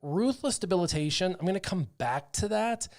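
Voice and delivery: ordinary delivery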